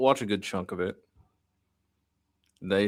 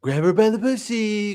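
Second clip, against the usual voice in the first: mock Trump quote; 11 semitones higher, 4 dB louder than usual. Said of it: "quoting Trump line".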